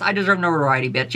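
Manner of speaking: ordinary delivery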